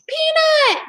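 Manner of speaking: high, loud call across the cave. Acted. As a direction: call for the lost mouse. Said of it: "Jack called".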